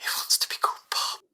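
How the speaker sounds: fast amused whisper. Acted. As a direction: whisper it aside to a colleague. Creators whisper to each other.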